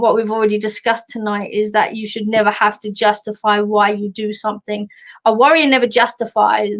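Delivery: ordinary delivery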